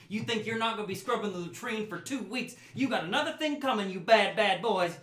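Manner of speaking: high, loud scolding mother building to a yell; mother rising into a shrieking scold; angry mother scolding. Acted. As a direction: tear into the boys like a furious mom. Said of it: "she says".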